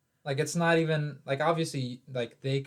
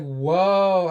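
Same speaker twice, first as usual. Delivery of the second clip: startled whoa; 3 semitones higher, 10 dB louder than usual. Retold or imitated. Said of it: "I was like".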